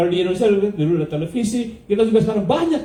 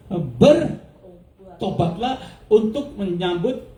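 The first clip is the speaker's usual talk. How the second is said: ordinary delivery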